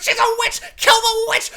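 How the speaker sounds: high, shrill-pitched mock kid yell; kids screaming. Child shouting at Wanda to kill the witch, high mock scream. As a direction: shriek it like a spooked child pointing. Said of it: "she's there screaming".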